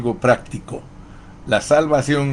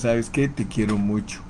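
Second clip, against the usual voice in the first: tender aside; 6 dB quieter than usual. Retold or imitated. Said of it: "le dices".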